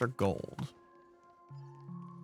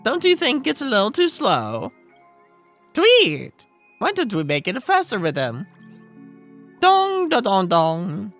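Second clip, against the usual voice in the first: high, loud, chirpy bird voice; bubbly songbird full of ideas; excited bird character; 9 semitones higher, 8 dB louder than usual. Acted. Game bird character speaks.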